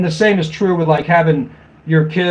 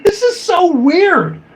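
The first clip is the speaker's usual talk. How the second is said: much higher, slow disbelieving yelp; incredulous outburst; 11 semitones higher, slower than usual. Retold or imitated. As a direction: react like you can't believe what you read. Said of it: "And I'm like".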